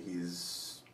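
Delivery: usual speaking voice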